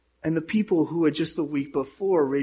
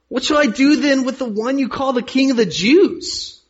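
higher, louder questioning voice; raised-voice appeal, lightly acted; Pilate asking; 9 semitones higher, 6 dB louder than usual. A narrator's reading. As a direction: put the question to the crowd, lifted and projected. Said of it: "Pilate asked them".

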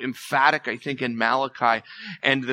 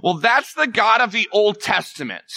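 higher, louder mock objection; lightly voiced skeptic pushing back; imitating a skeptic; 8 semitones higher, 5 dB louder than usual. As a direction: voice the doubter's retort. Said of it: "Some of you might go".

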